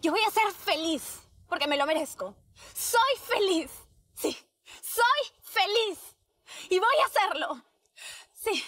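rising loud self-encouragement; determined pep talk. Acted. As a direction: build up to a confident shout.

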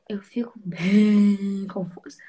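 slow whisper, drawn out. Shown as a demonstration. Host repeats her confusion to her fans, in a slow whisper. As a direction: whisper it slowly to the camera.

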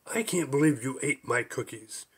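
ordinary delivery

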